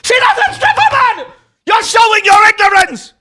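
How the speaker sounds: very high, loud mock British shriek; hammed-up British meltdown at full shout. Host as Buckby defending his fiancée, screaming British voice. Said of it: "read Buckby's voice in a British voice".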